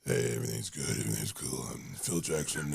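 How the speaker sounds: very low, creaky drone; mock a gangly coach's deep, unbothered voice; comic rumbling, laid-back introduction; Phil Jackson. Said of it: "he's like".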